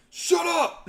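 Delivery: high, loud snapped shout; frustrated yell. Retold or imitated. Driver shouting at his laptop, frustrated yell. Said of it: "he's like".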